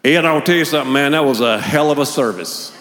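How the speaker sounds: booming, slapping-the-back praise; loud lobby compliment to the pastor; loud hearty churchgoer. Retold or imitated. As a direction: greet him like a buddy after a great show. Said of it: "I'll go out into the lobby".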